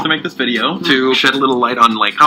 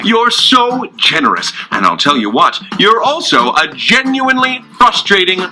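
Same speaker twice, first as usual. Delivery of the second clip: higher, hammed-up jolly Santa sarcasm; mocking Roman puppet; 9 semitones higher than usual. Roman puppet sarcastically playing Santa at Logan. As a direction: play Santa to mock him.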